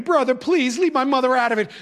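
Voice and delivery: put-on teen pleading with his teacher not to call his mother, lightly wailed; wailing plea. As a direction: beg like a scared kid. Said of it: "the wailing started again".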